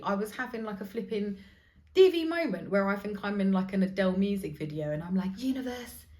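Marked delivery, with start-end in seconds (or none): 5.4-6.1 mock American accent, slightly higher pitch. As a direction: talk to the universe like an American. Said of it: "doing this in American".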